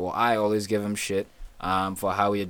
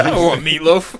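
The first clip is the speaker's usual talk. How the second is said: loud, higher, hammed-up grumble; mock complaint; 6 semitones higher, 10 dB louder than usual. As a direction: play a spouse fed up with dinner. Acted spouse in domestic skit.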